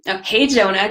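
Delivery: ordinary talk, nothing marked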